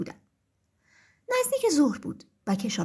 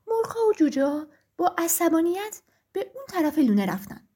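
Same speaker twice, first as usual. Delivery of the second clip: plain reading voice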